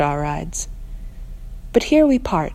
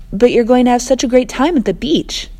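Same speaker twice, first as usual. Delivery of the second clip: louder, higher, forced cheer; raised voice; 5 semitones higher, 4 dB louder than usual. Acted. Narrator calling to friends over rain, forced cheer. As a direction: push it over the rain, half-meaning it. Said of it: "trying to be heard over the rain".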